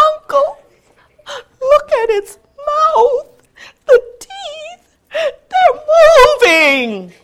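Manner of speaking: very high, cracking alarmed cry; frightened nephew. Acted. Nephew crying to uncle as teeth move, frightened. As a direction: shriek as the teeth start moving.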